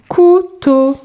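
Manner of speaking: high, segmented teacher's demonstration; syllable by syllable. Shown as a demonstration. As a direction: call out "Couteau" in two beats. Exercise word for young readers.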